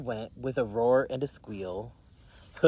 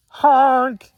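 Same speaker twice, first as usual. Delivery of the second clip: very high, loud goose honk; yelled honk; 11 semitones higher, 12 dB louder than usual. Acted. Goose honks at the racers, loud and high. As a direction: blare it like an excited goose. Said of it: "yelled Goose".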